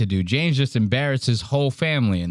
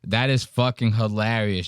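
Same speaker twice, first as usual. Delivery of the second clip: normal talking voice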